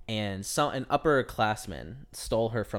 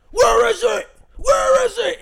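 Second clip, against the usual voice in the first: much higher, much louder mock shout; imitated deputy shouting; 12 dB louder, slower than usual. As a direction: yell it like a cop pinning a kid to a locker. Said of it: "He was like".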